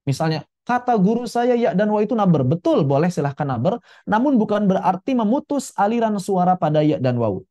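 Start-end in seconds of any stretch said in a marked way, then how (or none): none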